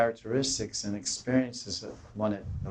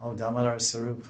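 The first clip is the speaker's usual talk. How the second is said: normal talking voice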